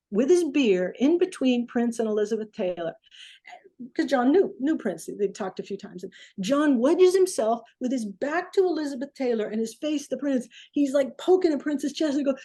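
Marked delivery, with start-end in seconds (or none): none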